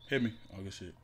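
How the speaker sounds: usual speaking voice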